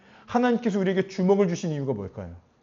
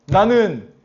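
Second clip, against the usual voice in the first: loud, confident proclamation; raised-voice confession of faith; bold declaration; 3 semitones higher, 6 dB louder than usual. Acted. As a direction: proclaim it with conviction. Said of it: "이것을 더 선포하고 확신해야 된다는".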